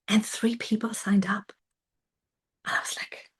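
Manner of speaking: hushed, disbelieving whisper; awed whisper. Real, not acted. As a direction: whisper it, almost in awe. Awed at new sign-ups.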